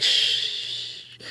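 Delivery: long soft imitated shush; crowd shushing. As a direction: hush like a waiting crowd. Imitates crowd hushing itself.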